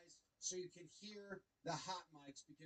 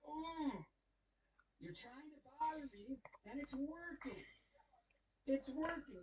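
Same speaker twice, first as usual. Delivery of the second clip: imitating the pitcher; 6 semitones higher, 4 dB louder than usual. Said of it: "Cavalli's like".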